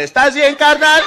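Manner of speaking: very loud, high mock shout; calling out. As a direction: holler it back at someone far off. Acted calling back to a victim.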